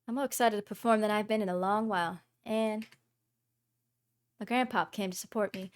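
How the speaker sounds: ordinary talk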